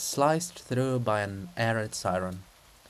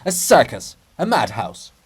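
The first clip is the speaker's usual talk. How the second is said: louder, fed-up exclamation; impatient outburst; 10 dB louder than usual. Acted. Princess exclaiming impatiently at the madhouse around her, loud. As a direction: snap out at the madness. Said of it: "waved the cross impatiently".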